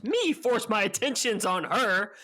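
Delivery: retold shout. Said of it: "So Matt shouts".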